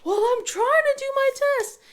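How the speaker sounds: very high, whiny protest; angry daughter. Retold or imitated. Daughter protesting to mom during a test, high whine. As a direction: whine it like an upset kid. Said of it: "she was angry".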